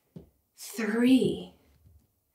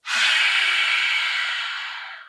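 long huffing breath out; fiery dragon exhale. Shown as a demonstration. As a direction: blow out fire like a dragon. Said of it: "Breathe fire out of your mouth".

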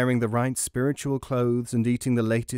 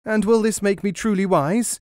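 eager disciple; 8 semitones higher, 5 dB louder than usual. Acted.

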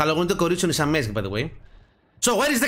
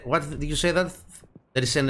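ordinary talk, nothing marked

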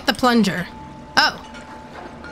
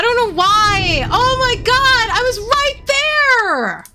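high, drawn-out protest; frustrated wail; 7 semitones higher, slower than usual. Real, not acted. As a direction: wail at the unfairness. Protesting a failure in game.

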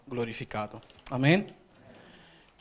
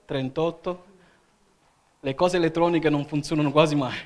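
ordinary delivery

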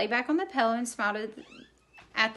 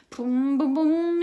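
motor noise; 4 semitones higher, 6 dB louder, slower than usual. A narrator's reading. Mouse revs the toy motorcycle.

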